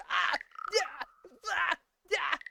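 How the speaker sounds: short high yelps; mock retching cries. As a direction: bursts of loud, high retching noises. Acting out retching at the sink.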